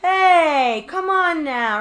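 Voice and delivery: high, loud mock crowd protest; audience protest. The audience pushing back at the heckler, loud and high. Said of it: "everybody in the audience is like".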